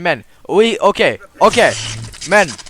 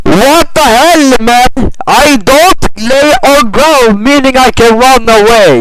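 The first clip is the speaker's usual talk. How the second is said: angry protest; 7 semitones higher, 6 dB louder than usual. Real, not acted. Protesting the taser arrest.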